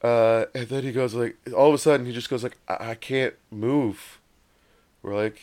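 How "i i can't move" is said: imitating a dazed stoner; stoned and stuck. Acting out stoned, frozen friend.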